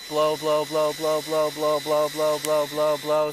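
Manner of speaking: coaching, rhythmic urging. Real, not acted. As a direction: push her to keep blowing. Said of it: "blow as hard as you can".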